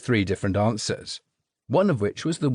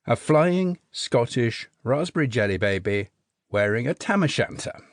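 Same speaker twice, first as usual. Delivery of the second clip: usual speaking voice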